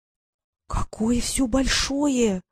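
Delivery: slow, breathless whisper of wonder; awed gasping whisper; gasped in awe. A narrator's reading. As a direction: whisper it slowly, stunned by the height. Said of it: "ахнула она".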